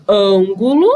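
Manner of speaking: "Ângulo" with a closed, nasal first A, said loudly; closed vowel. Shown as a demonstration. Teacher giving a circumflex example, closed A in "Ângulo". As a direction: punch out "Ângulo" with the stressed A kept closed. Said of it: "indica som fechado".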